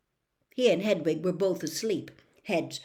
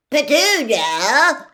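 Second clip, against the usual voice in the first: slow, much louder and higher shout; 8 semitones higher, 13 dB louder, much slower than usual. Acted. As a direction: call her name across the hall. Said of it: "shouted Aunt Marge".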